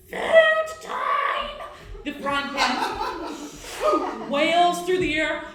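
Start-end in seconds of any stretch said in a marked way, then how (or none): 0.1-1.5 high, loud, breathy monster shout; a skittering creature shrieks for dinner; hungry creature cry. Acted. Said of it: "sort of skittering body comes forward going".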